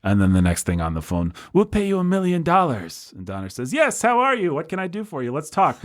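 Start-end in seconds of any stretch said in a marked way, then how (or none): 1.5-3.1 high-pitched mock producer making an offer; imitated producer's pitch. European producer tempting a director by phone, high mock pitch. 3.7-5.8 suddenly keen, pitched way up; mock eagerness. Director won over by a million-dollar offer, high mock eagerness.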